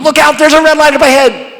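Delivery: high, loud shouted warning; passenger warning. Acted. Front seat passenger shouting a warning at the driver. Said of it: "The person in the front seat goes".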